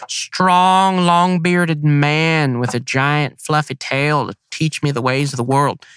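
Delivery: lightly voiced lost hen luring a big tom; flirty hen voice. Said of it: "I keep giving them the yelps".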